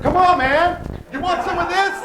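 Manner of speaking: high, loud taunt; mock fighting challenge. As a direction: challenge him like picking a fight. Acted challenge to a troublemaker.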